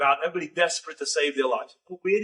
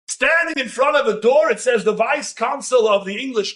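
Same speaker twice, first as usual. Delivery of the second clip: excited storytelling; 6 semitones higher than usual. A narrator's reading. Storyteller to listeners, reliving the storm at the gate, swept-up excited narration. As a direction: build the rush as the rain breaks and everyone runs. Downpour, crowd storms the building.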